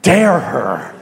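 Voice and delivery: loud, mock-indignant outburst; indignant re-enacted snap, punched word by word; retold angry snap. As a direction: act out losing your temper, loudly. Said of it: "I snapped".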